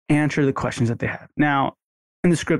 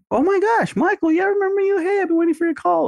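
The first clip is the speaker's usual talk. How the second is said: much higher, eager gushing voice; mock gushing excitement, pitched way up; overeager patient. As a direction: gush like a thrilled patient who was waiting. Excited patient imitated by trainer.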